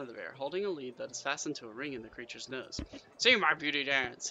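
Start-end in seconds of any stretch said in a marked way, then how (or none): 3.2-4.3 loud, slow dwarf showman's growl. A narrator's reading. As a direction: growl it out slowly to the crowd. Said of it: "he growls".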